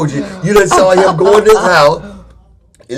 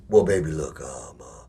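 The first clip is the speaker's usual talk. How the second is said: imitating smooth-talking boyfriend; 14 dB quieter than usual. Imitating broke boyfriend's excuses.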